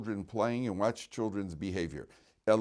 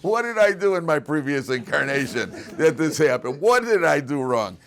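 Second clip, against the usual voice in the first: mock lament; 5 semitones higher, 11 dB louder than usual. Mock lament over painful reading.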